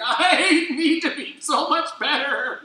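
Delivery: high, slow, laughing confession; laughing self-rebuke. Retold or imitated. Hearing Francis Chan preach, laughing at himself. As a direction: laugh at yourself while admitting it. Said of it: "I'm like".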